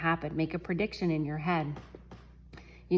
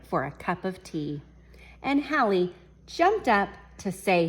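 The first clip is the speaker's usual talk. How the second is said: story reading; 3 dB louder than usual. A narrator's reading. Reads picture book to class.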